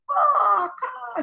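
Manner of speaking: high shouted crow call; girl copying the crow, loud and high; child cawing back. A narrator's reading. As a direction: answer the crow in his language. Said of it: "shouted Franny".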